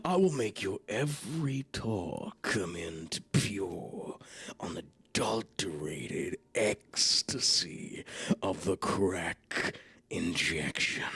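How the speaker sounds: very low, slow sultry growl; growling in your ears. Pretend. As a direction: growl it close and drawn-out, as a mock seduction. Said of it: "nothing but growl in our ears".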